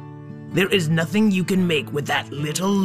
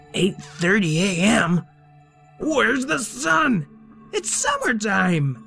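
alarmed shout; 7 semitones higher, slower than usual. Acted. Hero panics at missing sun.

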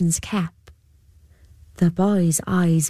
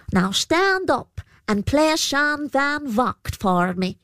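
higher, slow commanding trickster; Púca voice; 7 semitones higher, slower than usual. A narrator's reading. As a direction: give the order like a sly fairy. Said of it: "the Púca commands the boy".

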